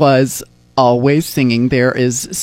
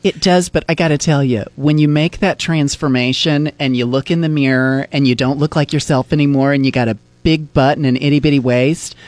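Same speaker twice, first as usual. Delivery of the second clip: ordinary talk, nothing marked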